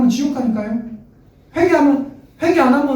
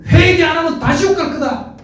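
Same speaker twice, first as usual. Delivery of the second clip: threatening prophet; 7 semitones higher than usual. Retold or imitated. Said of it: "이렇게 얘기 안 했어요".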